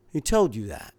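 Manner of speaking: a touch of surprise on told; asked with mild surprise at told; surprised. Acted. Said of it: "looked at her in surprise".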